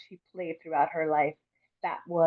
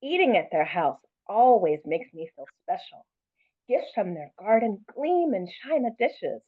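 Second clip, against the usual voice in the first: put-on lively read-aloud, lifting for the child's voice; story reading; 4 semitones higher than usual. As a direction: read it warmly to a class. Reads picture book aloud.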